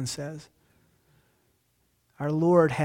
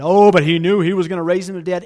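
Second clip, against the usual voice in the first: mock objector; 2 semitones higher, 9 dB louder, faster than usual. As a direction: fling the objection back loudly. Arguing with commentators.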